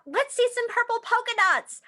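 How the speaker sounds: high, loud teasing call; a classmate shouting to tease; kid yelling. Acted. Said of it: "One yelled out".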